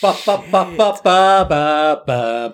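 loud high victory chant; triumphant fanfare. Real, not acted. Celebrating a correct answer.

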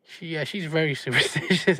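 usual speaking voice

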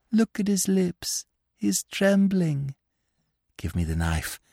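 menacing hush. Acted. Widow hissing at captive.

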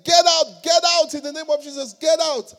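high, loud repeated order; exorcism command. Acted. Young ministers struggling with a stubborn demon, insistent shouting. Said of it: "They were saying".

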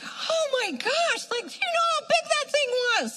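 very high, incredulous squeal; retold astonished outburst. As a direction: squeal it out in amazed disbelief. Retold shock at a healing.

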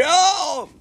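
sudden high yelp of surprise; startled oh. Retold or imitated. Rider startled by friend whipping past, high yelp. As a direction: yelp as he flies past you.

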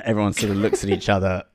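ordinary talk

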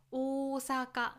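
flat Kansai 「大阪」, every mora held at one pitch; 関西弁の大阪. Shown as a demonstration. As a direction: say 「大阪」 evenly from the very start, Osaka style. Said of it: "フラットに言います。". (関西アクセントで平らに「大阪」)

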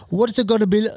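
a high, lightly put-on Indian accent; mock Indian accent, cut off mid-word; playful Indian accent. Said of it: "that was more an Indian accent".

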